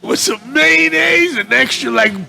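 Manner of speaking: high, slow, half-shouted order; frantic order. Retold or imitated. Hiding from the cop, ordering at Arby's in a high rush. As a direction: blurt the order while panicking.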